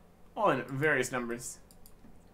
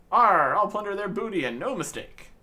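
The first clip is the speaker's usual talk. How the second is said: loud hammed-up pirate growl; 5 semitones higher, 7 dB louder than usual. Lad vowing to the captain in a loud hammed-up pirate voice. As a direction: boast it like a stage pirate. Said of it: "never heard anyone keep harping on like that".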